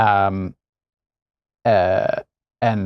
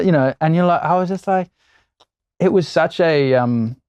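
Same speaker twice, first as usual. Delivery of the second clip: normal talking voice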